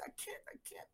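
whispered desperate refusal; whispering to husband, too anxious to stay; retold whisper. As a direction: breathe it out, unvoiced and afraid. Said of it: "I say".

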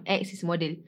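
normal talking voice

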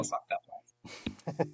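whisper. Real, not acted. Punchline dropped to a whisper.

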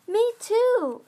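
cried out. Acted. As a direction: call it out eagerly. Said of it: "cried Or-, Orchid".

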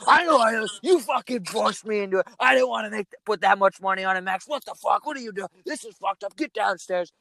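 high, whiny mock of an angry dad. Son mimicking his angry dad after the missed putt, high and whiny. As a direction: squeak out dad's complaints as a put-on whine. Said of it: "my dad's just gazing, fucking devil stare at me".

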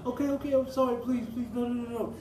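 high, pleading mock sob; hammed-up tearful begging; imitating her crying. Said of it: "she just started crying and was like".

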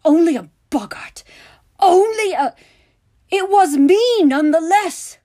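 high, very loud outburst; indignant full-voiced shouting; furious. A narrator's reading. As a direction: throw the words back in outrage. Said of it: "Severus was furious".

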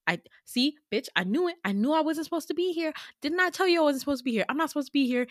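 high, rushed panicked rambling; freaking out. Retold or imitated. Student panicking about being exposed, acted freaking out. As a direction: blurt it fast and high, mock panic. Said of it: "I started freaking out".

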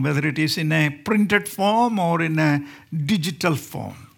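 usual speaking voice

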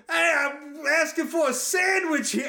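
high, drawn-out, badly faked New York yell; Markiplier's horrible New York accent. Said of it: "he does the most horrible accent".

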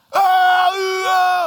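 high loud wailing oh of a fit; mock tantrum yell. Daughter throwing a fit in the van, loud high wail. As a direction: shout it like a child's tantrum.

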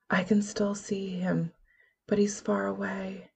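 whispered, no voice. A narrator's reading. Willow whispering that Mark is far away. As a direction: breathe it out in a whisper.

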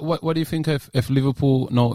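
ordinary talk

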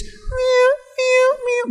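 high-pitched meows, held level; plaintive little cat cries from behind a door; imitated cat meow. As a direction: mimic a trapped cat meowing in the night. Imitating trapped cat at night.

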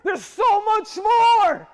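slow soaring preacher cry; climactic shout. Acted. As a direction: cry it out to the back row.

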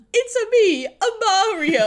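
high, loud mock-Italian Mario voice; terrible Italian accent. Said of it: "that terrible Italian accent".

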